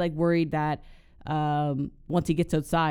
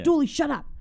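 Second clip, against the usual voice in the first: higher, hammed-up impatience; irritated snap; 10 semitones higher, 3 dB louder than usual. Sterling snapping at a whining Dooley, mock irritation. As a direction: cut him off sharply.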